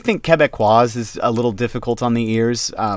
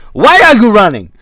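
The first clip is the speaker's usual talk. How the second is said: 1 mock shout; 11 semitones higher, 13 dB louder than usual. Acted shout at someone.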